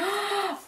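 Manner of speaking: quick sharp gasp of surprise; a little breathless gasp; short gasp. Real, not acted. Seeing the gift inside, a quick surprised gasp.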